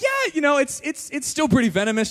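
high, hesitant reassurance; imitated consoling. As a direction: imitate a wife trying to soften the blow. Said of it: "trying to make me feel better".

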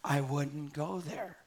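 soft, slow inner warning; a hushed, voiced nudge of caution; low-key gentle warning, drawn out slowly; gentle whisper. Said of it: "this gentle whisper go off inside of you".

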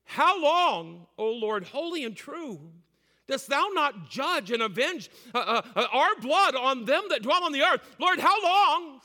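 high, loud cry of the martyrs. Acted. Preacher voicing the martyrs crying to God, loud. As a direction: cry out to heaven, full voice. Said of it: "with a loud voice saying".

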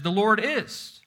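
"is" punched at the end; stress on IS. Shown as a demonstration. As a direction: land firmly on the final "is". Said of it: "put emphasis on the word is".

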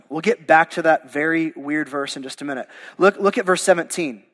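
ordinary talk, nothing marked

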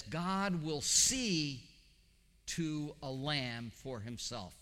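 very slow with "see" stretched out and stressed; literal Hebrew reading. Shown as a demonstration. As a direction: linger on "see" as if translating word by word. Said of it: "the Hebrew says".

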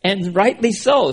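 ordinary talk

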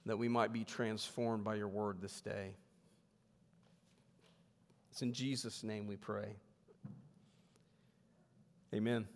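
hushed, reverent prayer voice. Acted. Pastor leads opening prayer.

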